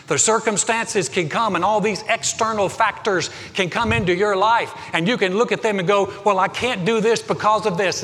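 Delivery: loud, high, driving preacher cadence; preaching at full force. Real, not acted. Pastor urging the congregation toward their destiny, preaching loudly. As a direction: preach it out to the whole room.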